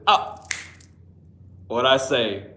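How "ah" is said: loud startled ah; sudden yelp. Real, not acted. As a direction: yelp out of nowhere. Sudden yelp greeting viewer.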